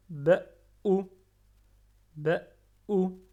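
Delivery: ba ou fort, ou appuyé; shadda appuyée. Shown as a demonstration. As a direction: appuyer sur ou. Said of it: "on appuie sur le alif". (ba ou avec shadda)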